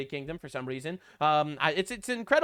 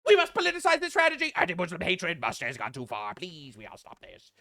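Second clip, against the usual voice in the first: mock politician; 2 semitones lower than usual. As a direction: ham up the hypocrisy.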